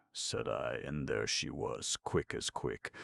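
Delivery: ordinary delivery, nothing marked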